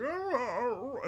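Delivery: high, whiny dog sounds. Retold or imitated. Owner voicing his dog Henry. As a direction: whimper like the dog. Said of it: "he's like".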